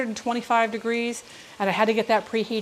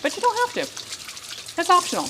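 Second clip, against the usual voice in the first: sing-song; 8 semitones higher than usual. Pretend. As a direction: tease it in a high lilting voice. Playful sing-song aside.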